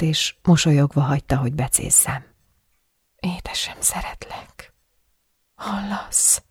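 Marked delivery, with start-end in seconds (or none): 3.2-6.4 soft voiceless whisper; much softer, intimate whisper to a sleeping lover; breathed into ear. A narrator's reading. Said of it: "leheltem a fülébe".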